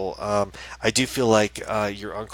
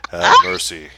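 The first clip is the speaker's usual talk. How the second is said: mock Uncle Jesse catchphrase; 3 semitones lower, 14 dB louder, slower than usual. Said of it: "Remember he would say that?".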